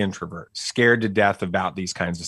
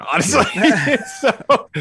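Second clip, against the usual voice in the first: higher, laughing as he talks; 13 semitones higher, 4 dB louder, much slower than usual. Real, not acted. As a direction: talk through the laugh. Laughing at his own parenting.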